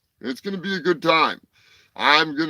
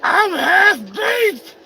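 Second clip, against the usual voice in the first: very high, cartoonish sidekick voice barging in; high-pitched puppet heckling the host; squeaky puppet voice; 9 semitones higher than usual. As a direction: pipe up like a cheeky little puppet. Turkey Surprise plugs his movie.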